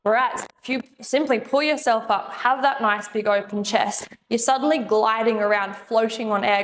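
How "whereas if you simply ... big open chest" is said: ordinary delivery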